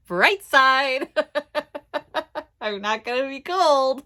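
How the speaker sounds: high, loud, cracking up; laughing. Real, not acted. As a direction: push the words out between real giggles. Laughs at finding a bright side.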